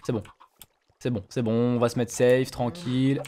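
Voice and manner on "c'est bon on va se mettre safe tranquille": ordinary delivery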